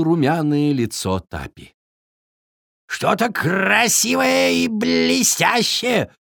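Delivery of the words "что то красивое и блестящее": high, drawn-out raven voice with rolled r; declaring raven. A narrator's reading. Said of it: "заявил ворон".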